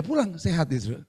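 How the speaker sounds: ordinary delivery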